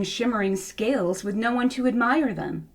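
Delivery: ordinary delivery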